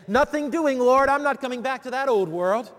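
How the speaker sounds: high, loud yell of refusal from far away. Pretend. Lazarus yelling at the Lord from heaven, loud high refusal. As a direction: holler it down from heaven, flatly refusing. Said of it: "I'd have yelled from heaven".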